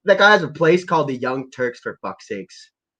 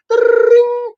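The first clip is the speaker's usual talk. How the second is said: mock phone ding; 6 dB louder than usual. Phone ringing in a fake call.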